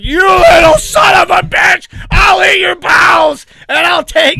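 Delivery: Farley bellowing from the freezing water, furious roar. Retold or imitated. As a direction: roar it like a madman falling through ice. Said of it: "he's like".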